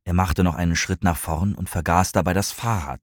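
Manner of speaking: ordinary delivery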